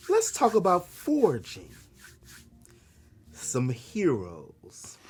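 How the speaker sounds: low whispered villain tease; mustache twirling villain. Pretend. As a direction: hiss it like a scheming villain. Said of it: "my villain laugh".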